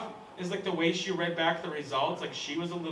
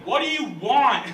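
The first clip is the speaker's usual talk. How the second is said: slow, louder, higher mock yell; yelling clerk; 6 semitones higher, 7 dB louder than usual. Subway clerk yelling at a customer walking in, hammed-up. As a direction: bark it like a fed-up sandwich guy. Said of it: "he just yells at me".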